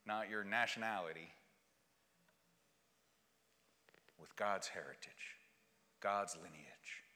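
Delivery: slow, low, measured sermon voice; hushed pastoral reassurance; earnest gentle preaching. Real, not acted. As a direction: lay each phrase down softly and deliberately. Preaches softly, deliberately.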